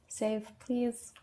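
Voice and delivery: ordinary talk, nothing marked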